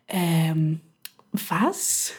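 low, quiet imitation of appalled disbelief; retold disgust, voice dropped low. Shop worker baffled by a mixed-beer request, mock disgusted. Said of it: "so richtig disgusted".

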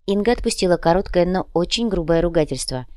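plain reading voice

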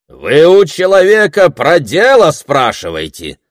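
high, loud cut-in; interjecting rebuke. A narrator's reading. Prince cutting into the squabble, rebuking. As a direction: put the crowd back on track. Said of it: "вставился князь".

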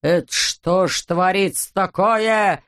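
ordinary delivery

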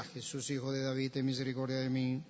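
soft, low and quick monotone; timid, half-hearted plea under the breath; meek mumbled plea. Acted. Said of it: "¿Usted cree que decía así o decía". (súplica tímida en voz baja)

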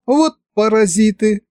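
high-pitched indignant exclamation; exasperated wife. A narrator's reading. Wife scolding the two sorcerers, exasperated. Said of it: "всплеснула руками супруга".

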